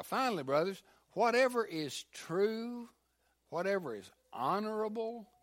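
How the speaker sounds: lightly lifted reading of scripture, each line building. Acted. Pastor reads Philippians to the congregation, building scripture reading. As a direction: read it out to the church, rising a little.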